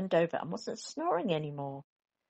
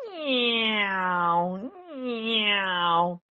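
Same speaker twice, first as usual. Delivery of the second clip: child's pretend noise; 4 semitones higher, 6 dB louder than usual. Baby Bear noises.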